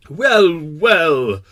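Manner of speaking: annoyed king. Acted. King replying to the bashful prince, annoyed. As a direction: sound put out, as a king. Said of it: "He was sputtering a little and seemed annoyed".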